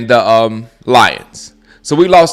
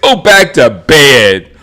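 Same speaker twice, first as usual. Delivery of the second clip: drawn out, slow go back to bed; slow emphatic repeat; 2 semitones lower, 4 dB louder, much slower than usual. Real, not acted. Host repeating his put-down to the fan, very slow. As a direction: spell it out word by word. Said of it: "repeats the line slowly".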